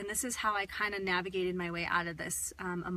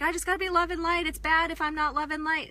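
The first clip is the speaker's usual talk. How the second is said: much higher, louder sing-song mockery; mocking spiritual people; 9 semitones higher, 8 dB louder than usual. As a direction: parody the love-and-light crowd. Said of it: "You're just like".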